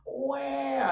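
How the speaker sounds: high, drawn-out self-pitying whine; mock victim whine. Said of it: "when you play the victim card".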